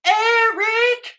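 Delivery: loud, high-pitched imitation screech; mock scream. Host showing why the actress is terrible, mock scream. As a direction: yell the name like an overdone movie scream. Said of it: "the amount of times she screams".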